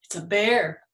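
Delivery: ordinary delivery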